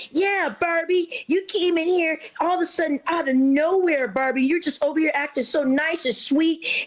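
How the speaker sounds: high, sneering imitation of catty women; mocking jealous women. Streamer mocks the jealous women who voted her out, high sneer.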